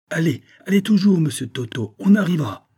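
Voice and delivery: very high, loud, panting push; breathless girl urging them on. A narrator's reading. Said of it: "Elle était haletante". (Susan haletante presse le chauffeur)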